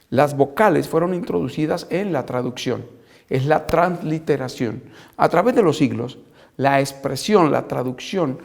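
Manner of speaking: ordinary talk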